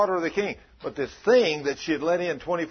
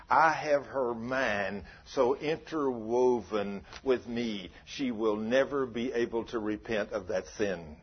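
lower, slowed-down menacing demon voice; 6 semitones lower, 7 dB quieter, slower than usual. Retold or imitated. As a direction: let the demon speak low and slow. Demon answering the preacher.

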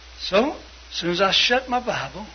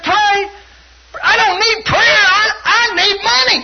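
very high, loud mock disbelief; scandalized outburst; 8 dB louder, slower than usual. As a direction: cry out like an outraged, desperate brother. Retold brother scorning prayer.

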